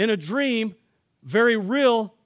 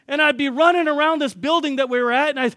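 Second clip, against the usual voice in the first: ordinary delivery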